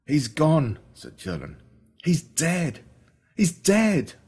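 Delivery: high, strained grief for his dead dog; grief-stricken. Acted. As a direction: say it like the loss just hit. Grieving friend about his dog.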